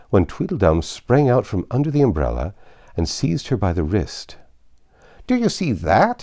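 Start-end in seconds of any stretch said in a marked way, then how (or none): 5.2-6.2 high, loud agitated cry; choked with passion. Acted. Tweedledum seizes Alice's wrist, crying high and loud. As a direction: burst out, pointing in alarm. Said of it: "in a voice choked with passion".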